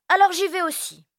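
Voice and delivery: put-on very high determined child; announcing. Elio announcing he is coming too, high and determined. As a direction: announce it bravely. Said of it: "Elio annonce".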